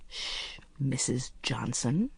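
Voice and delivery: hushed, slow and secretive; conspiratorial whisper. Acted. Agent hushing Mrs. Polyfax before leading her off, whispered. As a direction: lean in and hush her like a fellow plotter.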